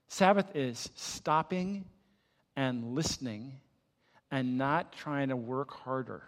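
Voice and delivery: ordinary delivery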